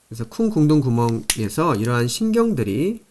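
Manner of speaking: ordinary talk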